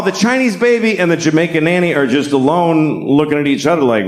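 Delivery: normal talking voice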